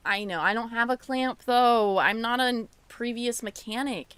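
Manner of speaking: loud exasperated complaint. Real, not acted. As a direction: call it out loud to the cat. Loudly complaining to cat Ember.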